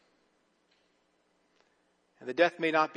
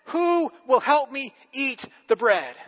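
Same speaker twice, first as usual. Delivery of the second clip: higher, slow and teasing; sly hen offer; 8 semitones higher, 4 dB louder, slower than usual. A narrator's reading. Hen tempts the lazy animals, higher and slow. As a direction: dangle the bread, drawn out. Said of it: "and says".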